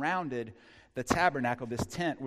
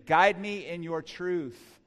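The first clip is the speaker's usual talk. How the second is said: slow call and response; 5 semitones higher, 6 dB louder, much slower than usual. Shown as a demonstration. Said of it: "Say it with me".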